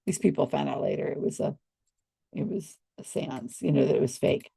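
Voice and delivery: ordinary talk, nothing marked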